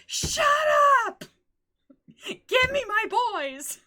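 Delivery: high, loud, drawn-out fan tantrum; hammed-up furious shriek; mock screeching yell. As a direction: screech it like a demanding fangirl. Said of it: "because I'm yelling".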